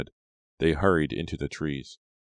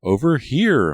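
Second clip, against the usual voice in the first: much louder, higher desperate call; crying out for help; 10 semitones higher, 10 dB louder, slower than usual. A narrator's reading. Maya crying for help from the edge of the woods, loud and high. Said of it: "Maya cried".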